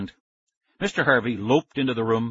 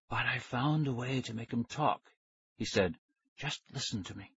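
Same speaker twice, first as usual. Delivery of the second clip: hushed scientist; 9 dB quieter than usual. A narrator's reading. Scientist reveals witness to ghost children, hushed.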